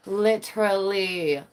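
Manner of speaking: slow drawn-out Literally. Shown as a demonstration. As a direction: stretch out Literally. slowly and deliberately.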